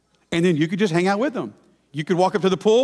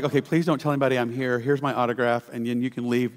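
lower, softer brush-off voice of a hurried celebrity; dismissive celebrity; 7 semitones lower, 4 dB quieter than usual. Retold or imitated. Preacher mimics a star dismissing fans, low and soft. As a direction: mumble it low like a star waving off a fan. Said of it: "these different ones who are just like".

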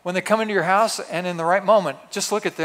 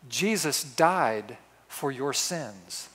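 softer and very slow, each word weighed; slow solemn quote; 4 semitones lower, 6 dB quieter, much slower than usual. Retold or imitated. Dying man softly asks the candy striper, slow and solemn. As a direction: lay it down gently like a last question. Quoting dying man's question.

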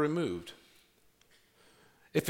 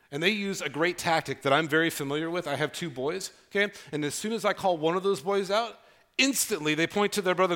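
ordinary talk

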